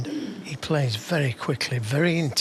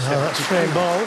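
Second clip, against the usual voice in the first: louder, lifted call of frame ball; excited; 3 semitones higher, 6 dB louder than usual. Real, not acted. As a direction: raise your voice with excitement. Frame ball reached, commentator lifts.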